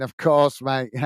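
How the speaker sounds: reading out with a low chuckle; amused chuckle. Real, not acted. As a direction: let a real laugh slip in at the end. Chuckling while reading chat message.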